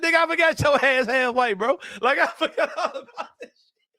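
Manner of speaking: high, laughing retelling of his own words; laughing disbelief. Retold or imitated. Teller repeating what he told his friend, laughing. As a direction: laugh it out in amazement. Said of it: "I said".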